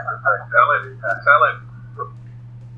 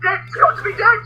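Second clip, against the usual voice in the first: high, excited mock Bart cry; imitating Bart. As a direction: squeal it out like the cartoon kid. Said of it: "sounds just like the Bart saying".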